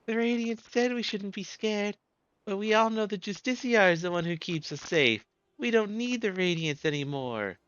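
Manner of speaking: put-on little girl, high and grateful; high child voice. Small girl thanking her rescuer, high child voice.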